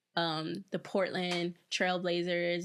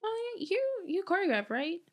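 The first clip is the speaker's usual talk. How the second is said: higher-pitched imitation of curious acquaintances; people recognizing her; 7 semitones higher, faster than usual. Dancer imitating team people who start to notice you, curious high voice. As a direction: imitate someone vaguely placing you, pitched up. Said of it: "they'll be like".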